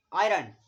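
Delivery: आयरन said loud, as one blended word; emphatic model word. Shown as a demonstration. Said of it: "आई का pronounce हो रहा है आए".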